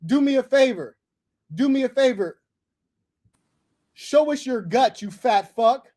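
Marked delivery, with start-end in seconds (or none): none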